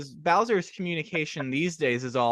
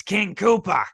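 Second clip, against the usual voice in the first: louder, higher cartoon villain voice; King Koopa; 6 semitones higher, 4 dB louder, slower than usual. Interviewer imitating a cartoon voice actor's switch, big villain voice. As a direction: burst out like a cartoon monster king. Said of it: "had a really calm sounding, like, regular voice".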